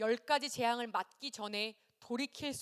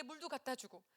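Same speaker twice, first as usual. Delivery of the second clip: usual speaking voice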